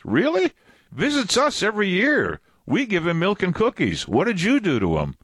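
very high, surprised alien voice, easing down toward the end; cartoon alien. Preacher voices the alien asking what people did to Jesus, very high surprised voice. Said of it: "And then the alien goes".

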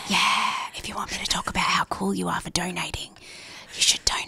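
hushed close whisper; ASMR whisper. Pretend. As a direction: breathe it softly into the mic. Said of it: "should we do it in the A S M R voice".

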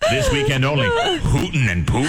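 high hammed-up announcer voice; mock announcer. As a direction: announce it like a weekend sale.

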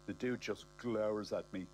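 ordinary delivery

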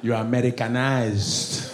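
put-on slow, low Nigerian accent; drawn-out judgment in a Nigerian accent; disapproving Nigerian. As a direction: pronounce it slowly as a Nigerian accusation. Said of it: "Even Nigerians be testing me".

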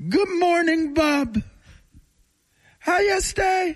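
put-on high squawky Jamaican-accented parrot. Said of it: "Did he have a Jamaican accent?".

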